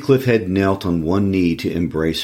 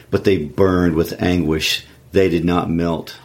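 usual speaking voice

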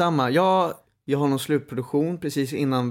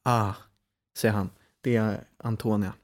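ordinary talk

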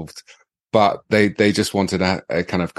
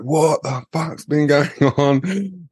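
mock angry dad; 7 semitones higher, much slower than usual. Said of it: "my dad rips the phone. He's like".

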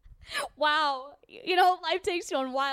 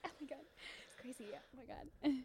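hushed; 16 dB quieter than usual. Acted. Awed whisper backstage.

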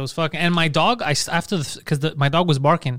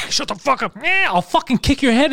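higher, slow snarling threat; furious threat; 9 semitones higher, slower than usual. Retold or imitated. Man threatening the cat in the night, furious.

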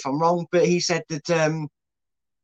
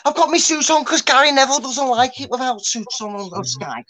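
high, loud Scouse presenter voice; hammed-up Scouse pundit with a Liverpool twang; Scouse accent; 8 semitones higher, 3 dB louder than usual. Pundit introducing his tactics board to viewers, in a high, loud Scouse accent. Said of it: "I'm gonna drop the Scouse accent".

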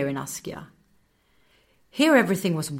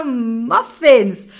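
laughing; 10 semitones higher, 10 dB louder than usual. A narrator's reading. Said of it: "Raga was wheezing with laughter".

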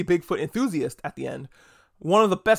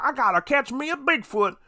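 eager Bigfoot hunter; 9 semitones higher than usual. Retold or imitated. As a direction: play a goofy yokel hunter itching for the chase. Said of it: "where everybody's like".

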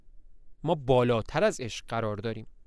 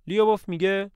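usual speaking voice

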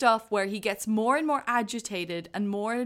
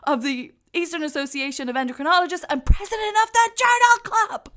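rising into a high, loud, half-laughing shouted tirade; mock angry rant; 7 semitones higher, 9 dB louder than usual. Retelling a doctor's furious corridor tirade, a shouting rant. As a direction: build from brisk listing to a ranting yell.

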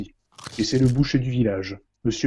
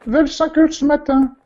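high, loud falsetto widow; high woman's voice; 11 semitones higher, 7 dB louder than usual. Acted. Widow answering the inspector in a high, loud voice.

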